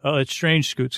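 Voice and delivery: ordinary delivery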